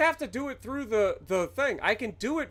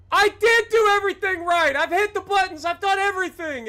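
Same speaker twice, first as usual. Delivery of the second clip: louder, higher insistence he did it right; angry shout; 6 semitones higher, 5 dB louder than usual. Real, not acted. Streamer complaining to chat about the game, angry shout. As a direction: protest at full volume.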